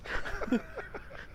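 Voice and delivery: laughing. Real, not acted. Laughs at own threat.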